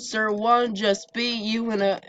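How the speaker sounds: ordinary delivery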